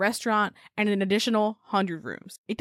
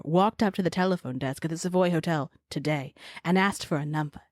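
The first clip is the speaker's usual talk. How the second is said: put-on Jeej, old-timey newspaper writer. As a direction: read it out like a vintage newspaper columnist, lilting line ends. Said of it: "She's an old-timey newspaper writer".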